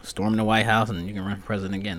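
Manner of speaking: usual speaking voice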